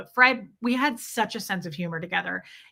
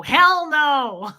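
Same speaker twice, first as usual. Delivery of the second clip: mock growl; 5 semitones higher, 8 dB louder than usual. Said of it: "growl at me".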